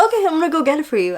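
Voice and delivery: fast, high easy reply; fast mock cheerful boyfriend; quick casual agreement. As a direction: say it like it's no big deal. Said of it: "he's like".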